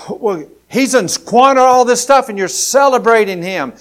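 put-on high, loud, indignant older brother; angry complaint. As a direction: spit out the injustice at full volume. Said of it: "And he's mad".

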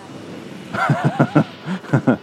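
long breathy laugh; helpless giggling fit. Real, not acted. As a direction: crack up at your own joke. Cracks up at own joke.

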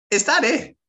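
dismissive cousin. Retold or imitated. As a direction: scoff it up high as the cousin. Cousin voiced, dismissive question.

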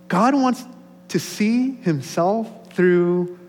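high, loud, drawn-out proclamation; impassioned preaching. Real, not acted. Pastor amazed at God's purpose, impassioned preaching.

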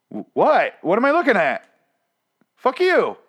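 high-pitched indignant yelling; exasperated outburst. Acted. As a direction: snap at the show in disbelief. Rants at the show.

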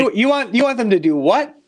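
what, you want them to do that?; surprised question. Real, not acted.

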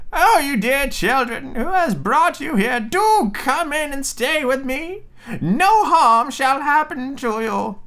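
put-on very high, slow old-woman voice; old witch, falsely kind. Old witch luring frightened children indoors, high sugary coaxing. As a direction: coax the children inside in a high, drawn-out croon. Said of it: "The old woman, however, nodded her head and said".

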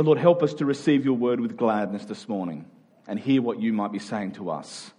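normal talking voice

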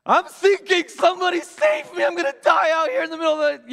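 high, loud panicked screaming for help; panicked shout. Retold or imitated. Sinking on a jet ski, shouting for help in panic. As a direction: yell like you're going under. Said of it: "luckily I have a really loud voice".

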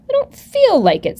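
high, reluctant toy rabbit; lightly voiced shy rabbit refusing; little rabbit voice. A narrator's reading. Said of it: "said the rabbit".

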